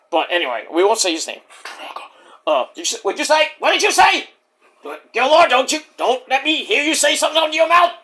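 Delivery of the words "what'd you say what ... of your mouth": high, indignant put-on voice; [name]. Wrestler warning the host about his words, high indignant impression. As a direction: challenge it, pitched well up. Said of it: "Okay, [name], I'm sorry".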